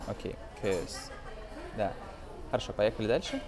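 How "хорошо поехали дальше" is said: ordinary delivery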